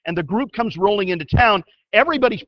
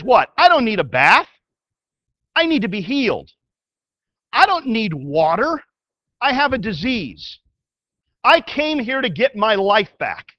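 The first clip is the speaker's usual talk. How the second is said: louder, offended protest of a proud man; indignant refusal; 3 semitones higher, 4 dB louder than usual. Retold or imitated. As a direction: scoff at the request, outraged. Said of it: "He says".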